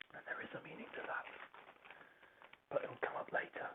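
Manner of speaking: hushed, breathy secret whisper; stage whisper teasing a plot secret. Pretend. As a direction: whisper it like a spoiler aside. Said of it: "I don't know if you can hear the stage whisper".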